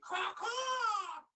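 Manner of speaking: high squawking caw caw; crow caw. Acted. Crow swoops down on the young man, cawing.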